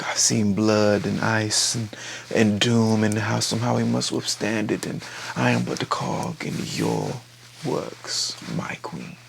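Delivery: bow and murmur your pledge; hushed vow. Retold or imitated. Daemon to his queen, a soft whispered vow.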